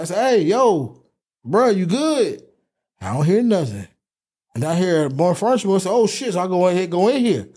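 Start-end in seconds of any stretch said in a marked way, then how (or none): 0.4-2.4 high, calling through a door. Retold or imitated. 5.9-6.3 high, sudden alarm. Retold or imitated. Realizes something's wrong.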